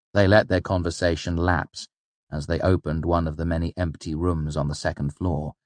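usual speaking voice

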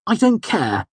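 high-pitched indignant outburst; hot indignation. A narrator's reading. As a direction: protest hotly, voice jumping up. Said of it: "Simon said hotly".

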